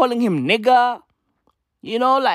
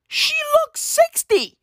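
much higher, slow, squeaky mock voice; mock shriek; slower than usual. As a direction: squeal it out like a scandalised gossip. Mocking her looks.

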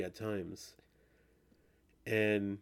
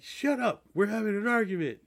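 much higher, squeaky mock "shut up"; mock snapping at the hologram; 11 semitones higher, 4 dB louder than usual. Player cutting off the alien boss mid-argument, high mock indignation. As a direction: pitch way up, comic outrage at the interruption.

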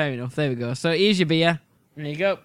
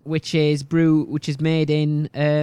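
ordinary talk

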